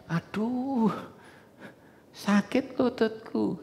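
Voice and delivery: mock the believer who groans about pain; a hammed-up sickly whimper; complaining patient. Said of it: "ngomongnya gini".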